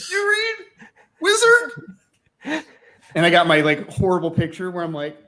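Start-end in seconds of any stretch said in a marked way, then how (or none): 0.1-2.6 squeaky starstruck fanboy. Retold or imitated. Mocking his starstruck younger self.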